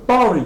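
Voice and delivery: "party" turned into "PAW-ree"; American pronunciation. Shown as a demonstration. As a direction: soften the T of "party" to a quick R. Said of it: "chez les Américains, eux prononcent ça".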